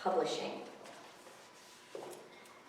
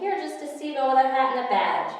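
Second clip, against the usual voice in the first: put-on amused kid; 7 semitones higher, 9 dB louder, faster than usual.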